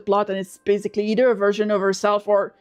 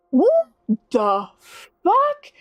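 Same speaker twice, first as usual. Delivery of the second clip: what the fuck, pitched way up; incredulous; 6 semitones higher, much slower than usual. Real, not acted. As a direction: blurt it out, shocked. Outraged reaction to the movies.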